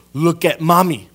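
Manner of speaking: imitated mother's firm command; mom demanding attention. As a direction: call a distracted boy to look at you. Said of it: "My mom used to do this".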